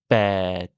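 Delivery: "Bête" low and sinking, long È, silent T; low falling tone. Shown as a demonstration. Teacher modelling Thai eight, low falling "Bête". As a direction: drop "Bête" down low with an unblown P. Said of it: "une voix grave et une intonation qui descend".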